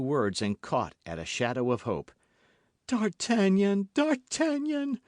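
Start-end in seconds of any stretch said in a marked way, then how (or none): 2.9-5.0 high, slow, loud pleading call; crying out. A narrator's reading. Milady crying out to D'Artagnan for mercy. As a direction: call out to him, begging. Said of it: "cried she".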